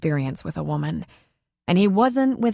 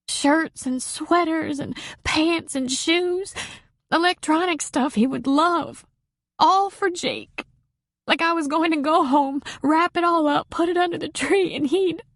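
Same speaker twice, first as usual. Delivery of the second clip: sobbing; 10 semitones higher than usual. A narrator's reading. Marie to Patty over the gifts for Jake, sobbing high. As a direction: sob the list out, pitched up high. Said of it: "He could hear the sobs".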